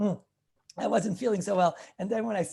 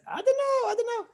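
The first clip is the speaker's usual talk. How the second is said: much higher, squeaky I don't know; mock doubtful sage. Rabbi mimics the sage doubting, squeaky and high. Chavis Yair's doubt acted out.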